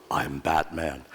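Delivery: low, deep superhero growl-style voice; Batman voice. Pretend. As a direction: drop the voice low for a mock superhero line. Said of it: "if you hear my voice go".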